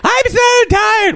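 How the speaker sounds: very high, slow mock whine; squeaky, drawn-out childish complaint; hammed-up overtired little voice; imitated whining partner. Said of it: "She's like".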